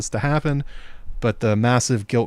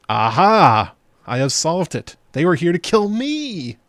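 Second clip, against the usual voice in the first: hammy detective; 6 semitones higher, 5 dB louder, slower than usual. Retold or imitated. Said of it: "putting on his little detective hat".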